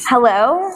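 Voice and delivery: "Hello?" with a rising question tune; upward inflection. Shown as a demonstration. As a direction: lift the end of "Hello?" like a question. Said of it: "everything with the upward inflection".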